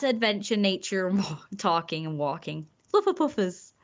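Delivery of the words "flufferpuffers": excited name. Acted.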